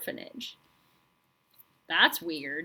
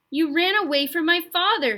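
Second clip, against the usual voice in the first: put-on higher, louder tearful boy; 7 semitones higher, 6 dB louder than usual. A narrator's reading. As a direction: weep it, accusing. Roderick tearful.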